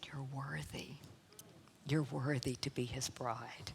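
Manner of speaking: soft unvoiced whisper of reassurance. God whispering to her heart on her wedding day, soft whisper. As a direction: breathe it out gently, barely voiced. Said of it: "God whispered in my heart".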